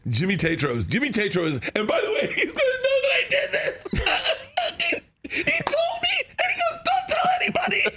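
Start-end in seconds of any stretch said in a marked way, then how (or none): 1.8-8.0 very high, squealing laughter through words; helpless high-pitched giggling confession; cracking up. Real, not acted. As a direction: barely get it out for laughing. Cracking up at betraying friend's secret.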